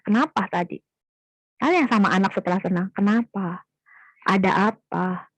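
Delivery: put-on tender check-in with a child who just stopped crying; gentle asking. Shown as a demonstration.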